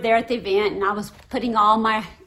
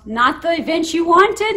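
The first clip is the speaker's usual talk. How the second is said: higher, louder imitation of an announcer; 7 semitones higher, 7 dB louder than usual. Announcer on the microphone to the mistaken swimmer, announcer voice. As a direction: project a teasing question like an announcer. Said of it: "the announcer in the microphone goes".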